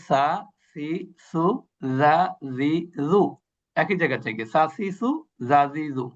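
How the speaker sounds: সা, সি, সু from the tongue tip at the upper teeth; drilling letter sounds. Shown as a demonstration. Teacher drilling the class on tongue-tip letters. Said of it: "উপরের দাঁতের আগা থেকে".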